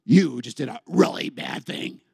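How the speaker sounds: low, slow devil voice; slow, taunting low delivery; accusing devil. Acted. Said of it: "the devil goes".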